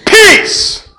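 high, loud command to the storm; commanding shout. Retold or imitated. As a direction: order the sea to be still. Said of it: "and he shouted".

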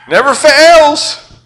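high, loud and slow, stretching fails; emphatic proclamation. Real, not acted. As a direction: ring it out like a promise. Repeated punchline, first take.

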